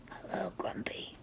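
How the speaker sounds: soft voiceless whisper; whispered answer. Acted. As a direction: breathe it out like a secret. Answers question in whispered aside.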